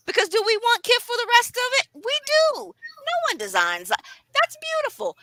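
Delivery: high excited gush; high, excited gushing about the designer. Real, not acted. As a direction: rave about her art, voice soaring. Gushing over the other artist.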